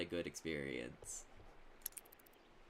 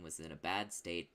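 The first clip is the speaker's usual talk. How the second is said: ordinary talk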